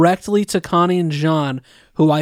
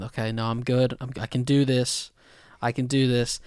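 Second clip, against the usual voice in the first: low, soft pep talk to oneself; hushed self-reassurance; 3 semitones lower, 6 dB quieter than usual. Retold or imitated. Viewer telling himself he can hold back tears, hushed self-reassurance. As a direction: quietly talk yourself out of crying, retold.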